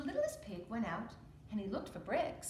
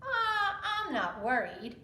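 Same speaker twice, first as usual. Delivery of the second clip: loud, carefree little pig voice; carefree littlest pig; 7 semitones higher, 8 dB louder, slower than usual. Acted. Littlest pig shrugging off his brother, carefree and loud. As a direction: brush off the worry, loudly. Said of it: "said the littlest pig".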